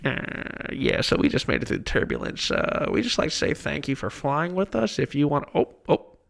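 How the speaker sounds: casual captain on the intercom; pilot cockpit headset. Retold or imitated. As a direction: announce it like a rattled pilot. Retelling pilot's announcement during turbulence.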